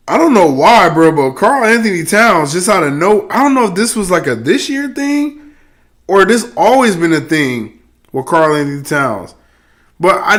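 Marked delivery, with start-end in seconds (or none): none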